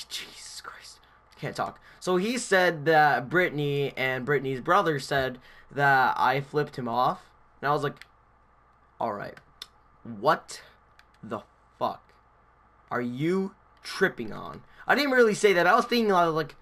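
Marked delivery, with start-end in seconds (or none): 0.1-1.8 whispered self-reproach; frustrated aside. Real, not acted. Muttered aside after stumbling on words. 9.0-14.6 halting disbelief. Retold or imitated. Acted bewildered reply, halting.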